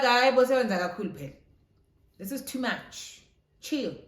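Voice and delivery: loud, high mock protest; exasperated protest. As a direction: act out telling a clingy partner to back off. Acted exasperated reply to clingy boyfriend.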